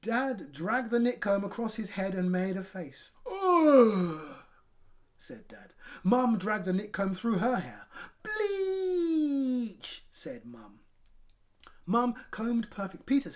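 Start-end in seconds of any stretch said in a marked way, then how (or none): 3.2-4.4 loud high groan of disgust; dad's loud disgusted ugh. Acted. Dad disgusted. 8.2-10.1 very high wail of disgust; mum's high disgusted cry; disgusted bleach. Acted. Mum disgusted.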